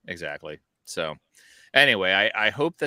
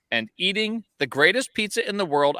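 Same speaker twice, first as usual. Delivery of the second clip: excited; 9 semitones higher, 4 dB louder than usual. Real, not acted. Emphatic praise of pizza.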